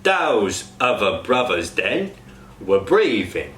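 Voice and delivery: slow Cockney, "other" as uvver, "breathing" as breeving; slow Cockney example; Cockney TH sounds. Shown as a demonstration. Coach demos TH sounds to cast. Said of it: "An example sentence".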